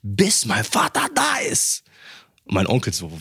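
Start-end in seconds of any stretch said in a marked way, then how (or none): 0.0-1.8 incredulous. Retold or imitated. Questioning uncle incredulously.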